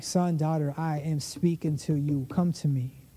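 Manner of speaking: low, fatherly reassurance; tender God. Acted. God calling son and daughter to come, tender. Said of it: "But God is like".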